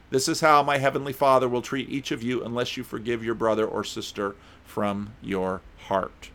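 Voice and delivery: ordinary talk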